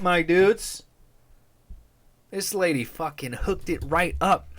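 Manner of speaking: ordinary talk, nothing marked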